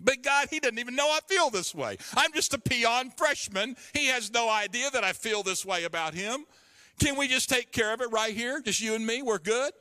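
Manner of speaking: put-on a young man haggling with God in a high whine; whiny bargaining.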